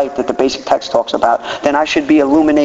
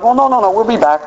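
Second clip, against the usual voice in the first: flustered reply; 9 semitones higher than usual. Retold or imitated. His flustered reply retold.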